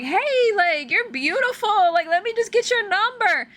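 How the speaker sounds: high, stretched-out imitation of a come-on; mock catcaller. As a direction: imitate a man pestering for a number.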